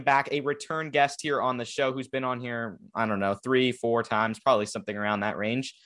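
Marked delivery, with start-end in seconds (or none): none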